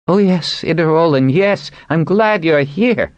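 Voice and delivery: high, loud eager greeting; bursting with excitement, pitched high; excitedly. A narrator's reading. Wanzer greets the seaman, high excited voice. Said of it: "Wanzer said excitedly".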